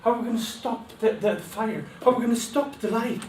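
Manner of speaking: high, fast, loud panicked pleading; frightened cry. Acted. People begging as the fire burns them, panicked.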